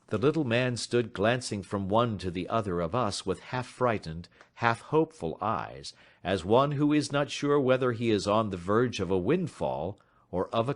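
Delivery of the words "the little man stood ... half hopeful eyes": plain reading voice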